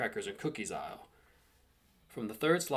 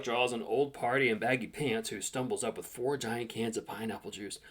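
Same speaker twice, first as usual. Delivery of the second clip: plain reading voice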